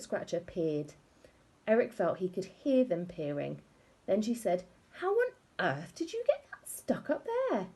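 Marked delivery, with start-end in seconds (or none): 4.9-7.7 high, disbelieving voiced wife; incredulous high wife. A narrator's reading. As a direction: gasp it out in disbelief. Wife amazed at husband.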